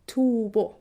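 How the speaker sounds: Swedish double tone on Tobo; two-tone word melody. Shown as a demonstration. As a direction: Tobo with both syllables toned, the first weighted. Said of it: "first tone is on the first".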